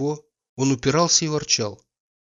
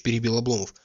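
usual speaking voice

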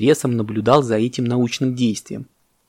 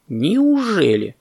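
slow, higher, stretched disbelief; surprised; 6 semitones higher, much slower than usual. A narrator's reading. Parrot hears bananas are gone, surprised. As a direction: draw the word out in amazement. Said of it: "удивился попугай".

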